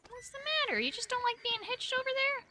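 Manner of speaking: high pet-talk questions. Real, not acted. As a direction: coo at the horse like a pet. Cooing at the horse.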